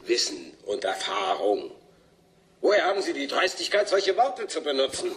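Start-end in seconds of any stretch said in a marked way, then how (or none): 2.6-5.1 scornful laugh. Acted. Amused scorn at the scientists.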